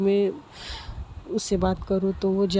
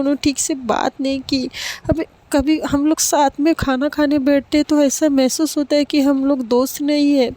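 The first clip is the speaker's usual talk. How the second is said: tearful outburst; 6 semitones higher than usual. Acted. Girl crying to her father about her friend, tearful and higher. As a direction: pour out the hurt to dad, close to tears. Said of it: "और खूब रोने लगती है।".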